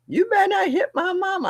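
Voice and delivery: high, insistent mock warning; retold high-voiced threat; warning mother.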